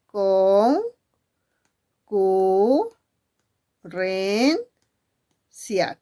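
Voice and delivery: Con, cu, ren, cia said slowly, syllable by syllable with pauses; split into syllables. Shown as a demonstration. Teacher splits concurrencia into syllables for pupils, slowly. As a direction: clap out each syllable. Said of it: "¿Cuántas sílabas?".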